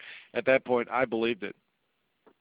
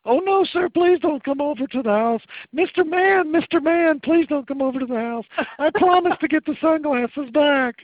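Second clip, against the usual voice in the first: pleading mother; 10 dB louder than usual. Retold or imitated. Panicked mom begs on phone.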